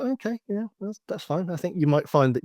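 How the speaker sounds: indulgent, knowing scepticism; sceptical thought. Retold or imitated. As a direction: humour him while doubting. Sceptical inner thought about Apple.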